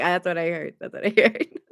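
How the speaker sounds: laughing through the words; giggling as she repeats it; amused, breaking into a laugh. Real, not acted. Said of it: "I'm laughing".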